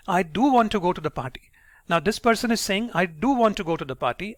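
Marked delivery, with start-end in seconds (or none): 0.1-1.5 "do" pitched high and stressed. Shown as a demonstration. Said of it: "his pitch is going high on the word do". 2.9-4.3 "do" raised in pitch; stress on DO. Shown as a demonstration. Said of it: "his pitch is going high on the word do".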